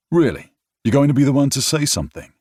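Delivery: stunned narrator challenging his friend; surprised. Acted. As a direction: throw it back at him in disbelief. Surprised retort to Crew.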